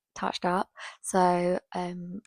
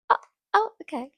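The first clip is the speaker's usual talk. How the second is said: taken aback; 9 semitones higher, 8 dB louder, faster than usual. Retold or imitated. Grandpa realizing she's serious.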